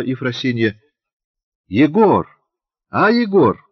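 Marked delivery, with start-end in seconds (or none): 1.7-3.6 higher, loud call through a curtain; a little girl calling loudly from behind the curtain. A narrator's reading. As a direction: shout it out across the room. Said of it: "крикнула Шурка из-за полога".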